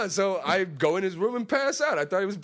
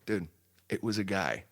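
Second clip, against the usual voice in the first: low, soft, dropped voice; quiet deadpan reveal; 9 semitones lower, 6 dB quieter than usual. Real, not acted. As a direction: drop the voice for the reveal.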